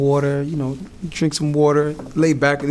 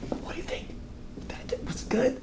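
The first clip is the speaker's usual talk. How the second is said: very soft, whispered check-in; imitating a lawyer's whispered question; confiding whisper; 12 semitones higher, 12 dB quieter than usual. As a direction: lean in and ask privately. Lawyer confers privately at table.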